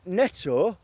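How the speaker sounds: ordinary talk, nothing marked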